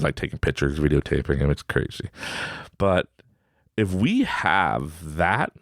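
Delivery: ordinary talk, nothing marked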